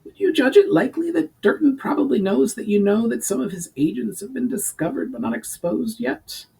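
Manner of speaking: high, pinched goblin adviser; high-voiced goblin laying out the problem. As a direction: pitch it up like a scheming goblin. Urgar questions the Duke.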